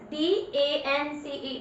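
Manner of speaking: spelling out D A N C E letter by letter, slowly; dictating the letters to pupils, slow and clear; slow spelled letters. Shown as a demonstration. Teacher to pupils, spelling letters slowly. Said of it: "जैसे डेंस".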